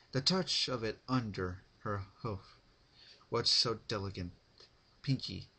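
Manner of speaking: ordinary delivery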